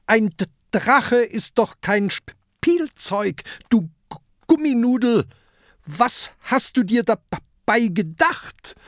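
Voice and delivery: high, loud stammering rebuke; pirate scolding the kraken; angry scolding. A narrator's reading. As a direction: tell the monster off. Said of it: "ruft Peter".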